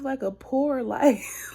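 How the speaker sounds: real laugh breaking into the words. Real, not acted. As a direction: laugh through the end of the question. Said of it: "I'm laughing".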